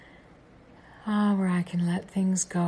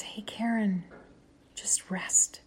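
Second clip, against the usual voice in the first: lightly higher, unhurried reassurance; gentle inner voice; 4 semitones higher than usual. Acted. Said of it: "you hear a voice that says".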